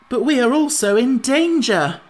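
high, loud, anxious objection. A narrator's reading. Pumpkinhead reminds Scarecrow of their danger, anxiously. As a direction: press the worry urgently. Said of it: "said the Pumpkinhead anxiously".

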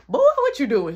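put-on loud, high-pitched challenge to a kid; mock startled demand. Walking in on Caleb on the phone, loud mock demand.

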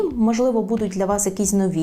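ordinary delivery

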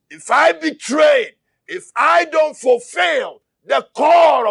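shouted sermon, driving hard; impassioned preacher; fiery preaching. Acted. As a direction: preach it at full force to the church. Preaching at full force.

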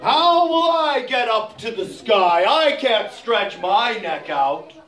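slow, drawn-out elephant voice; a big slow beast unsure of himself; slow worried elephant. Acted.

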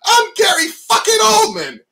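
imagined star actor topping the director, mock yell; mock ego shout. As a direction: roar your name back even bigger.

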